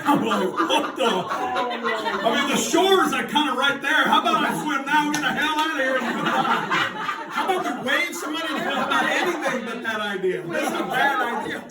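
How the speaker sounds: high, laughing protest; giggling at the absurd idea; laughing disbelief. Real, not acted. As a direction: laugh off the crazy plan.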